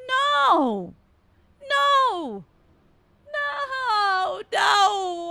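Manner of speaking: high, loud scream of No. Real, not acted. Streamer screaming at the game's cop. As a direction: shriek it in real panic.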